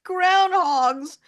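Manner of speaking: can't believe they ate groundhogs; shocked disbelief. Real, not acted. Shocked at the quiz answer.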